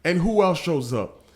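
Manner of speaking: high, loud preaching build; rising excitement. Real, not acted. Preaching crescendo.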